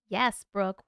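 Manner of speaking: ordinary talk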